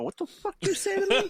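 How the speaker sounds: high, far-off mock challenge; squeaky faux-aggressive comeback; mock tough guy. Friend picturing him defying his dad in a mock voice.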